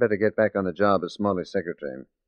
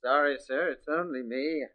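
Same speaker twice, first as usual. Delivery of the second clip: meek apology; 9 dB quieter than usual. Acted. Detective caught, posing as secretary.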